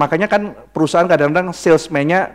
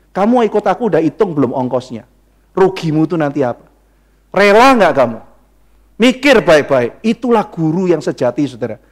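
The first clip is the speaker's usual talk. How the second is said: usual speaking voice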